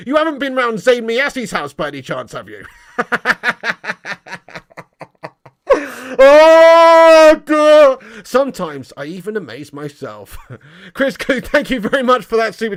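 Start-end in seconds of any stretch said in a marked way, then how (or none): 3.0-6.1 long, helpless fit of laughter; laughing. Real, not acted. Cracks up at his own joke. 6.2-8.2 loud, high, drawn-out sigh after laughing; tickled exclamation. Real, not acted. Recovering from a laughing fit.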